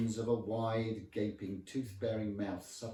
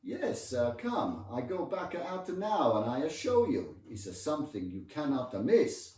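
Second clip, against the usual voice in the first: loud, high, smiling Italian husband; enthusiastic Italian accent; 6 semitones higher, 8 dB louder than usual. Acted. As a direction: urge him eagerly in lilting Italian English. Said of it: "He was actually smiling enthusiastically".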